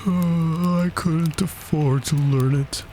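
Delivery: long high sigh into a doleful line; sigh, then confess wistfully; mournful turtle sighing; sighing regret. A narrator's reading. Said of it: "said the Mock Turtle with a sigh".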